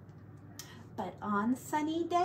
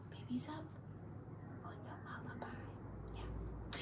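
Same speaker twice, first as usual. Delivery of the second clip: whisper; 4 semitones higher, 16 dB quieter, faster than usual. Real, not acted. Whispering at bedtime.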